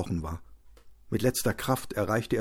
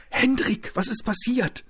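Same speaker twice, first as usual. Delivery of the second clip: much higher, alarmed voice of the wife; horrified; 13 semitones higher than usual. Acted. Wife sees her beaten husband at the door, horrified. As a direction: cry out in shock at the sight. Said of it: "Ein entsetzter Laut entfuhr ihr".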